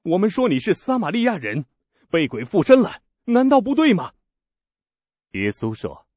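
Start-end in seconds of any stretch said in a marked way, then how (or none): 0.0-4.1 high, loud taunt rising at the end; scolding crowd. Acted. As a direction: jeer at him as a Samaritan. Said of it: "犹太人骂他说".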